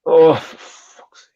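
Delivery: slow, pained whispered groan; anguished whisper. Real, not acted. Fan watching his team concede, real anguished whisper. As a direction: breathe the curse out in despair.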